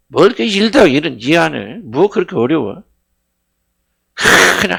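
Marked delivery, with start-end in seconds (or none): none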